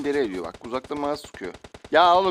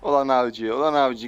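ordinary talk, nothing marked

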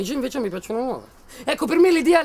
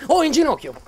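loud, higher barked command; barked order; 5 semitones higher, 5 dB louder than usual. Acted. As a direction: snap the order down at him. Ordering hostage to kneel.

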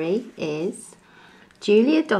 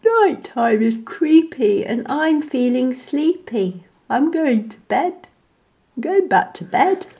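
higher drowsy voice; sleepy monkey; 5 semitones higher than usual. A narrator's reading. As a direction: announce bedtime wearily. Sleepy monkey going to bed.

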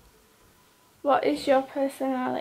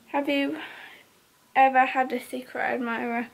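ordinary delivery

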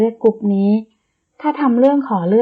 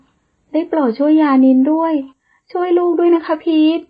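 higher, slow, begging through tears; desperate mother begging tearfully, slow and high; tearful pleading; 6 semitones higher than usual. Acted. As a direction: plead for her child, voice near breaking. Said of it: "หญิงสาวน้ำตานองหน้า".

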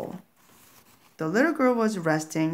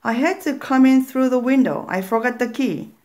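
higher, louder puzzled mother; mother explaining, raised and higher; mother's voice; 6 semitones higher, 5 dB louder, faster than usual. Acted. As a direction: mom calling to the kids. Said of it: "The voice continued".

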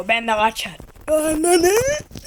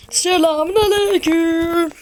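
hammed-up greeting; 5 semitones higher, 5 dB louder, slower than usual. Drawn-out greeting to game character.

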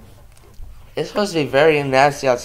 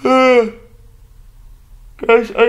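high, loud word through a yawn; 9 semitones higher, 4 dB louder than usual. Real, not acted. Vlogger apologizing to viewers for yawning, word swallowed by a yawn. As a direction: let a real yawn stretch the word. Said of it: "so sorry I'm yawning today".

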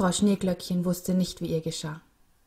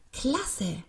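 put-on higher-pitched happy boy; delighted; 5 semitones higher than usual. A narrator's reading. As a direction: exclaim it with childlike joy. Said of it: "freute sich der Junge".

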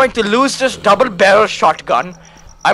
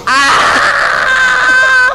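high startled yell; panicked scream; 11 semitones higher than usual. Real, not acted. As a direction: scream in fright. Attacked by zombies.

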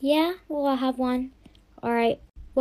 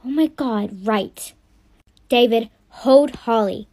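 usual speaking voice

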